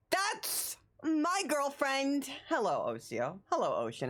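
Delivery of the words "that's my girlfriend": gushing, high and slow. Real, not acted. Gushing over girlfriend's sub.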